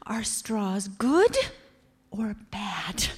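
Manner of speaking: normal talking voice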